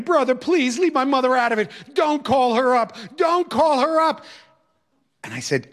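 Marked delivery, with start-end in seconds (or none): 0.0-1.7 wailing plea. Retold or imitated. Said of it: "And the wailing started again". 1.9-2.9 higher pleading wail; wailing plea. Retold or imitated. Student begging teacher not to call his mother, wailing. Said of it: "And the wailing started again". 3.2-4.3 even higher and slower, a drawn-out plea; rising desperate wail. Retold or imitated. Student pleading again with teacher, slower and higher wailing. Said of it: "And the wailing started again".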